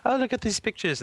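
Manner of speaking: high sing-song voice offering a toy; showing a child. Retold or imitated. A stranger showing the baby an iPad, high sing-song. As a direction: coax a child to look, high and sweet.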